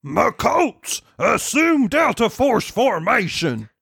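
mock military order; battle commander. Pony commander ordering troops into formation, mock military call.

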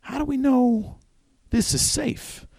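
high, hesitant worry; nervous Eddie. Acted. Eddie doubts the door to his wife, high and uneasy. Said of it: "And Eddie's like".

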